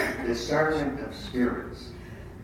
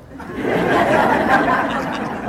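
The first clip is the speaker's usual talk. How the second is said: real laughter; 7 dB louder than usual. Real, not acted. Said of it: "Speak yourself".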